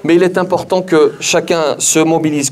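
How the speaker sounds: ordinary talk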